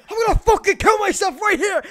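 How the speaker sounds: high, very loud hammed-up rant; mock rage. Co-host imitating the vulgar streamer kids hear, mock raging. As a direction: bellow it in over-the-top gamer rage. Joins in acting the ranting streamer.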